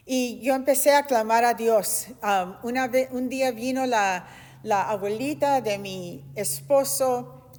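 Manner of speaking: ordinary delivery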